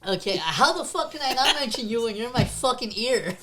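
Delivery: loud playful exasperation; mock fed-up, half laughing; exasperated. Real, not acted.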